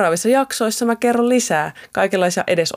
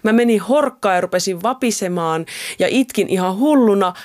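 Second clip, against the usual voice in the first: ordinary talk, nothing marked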